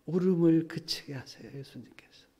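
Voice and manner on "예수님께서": breathy whisper, no voice. Real, not acted. Preacher whispering to the congregation that the Lord stops the crying. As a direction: hush it tenderly.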